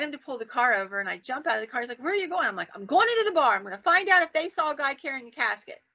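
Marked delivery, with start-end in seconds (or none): 2.0-2.5 high-pitched, baffled retort; alarmed question. Retold or imitated. Friend's alarmed question, acted. 2.7-5.8 determined retort. Retold or imitated. Retold determined reply to friend.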